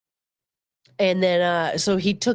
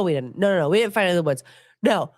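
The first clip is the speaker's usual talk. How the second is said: ordinary delivery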